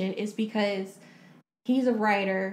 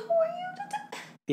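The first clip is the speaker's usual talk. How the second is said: outraged retort; 4 dB quieter than usual. Retold or imitated. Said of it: "I would have been like".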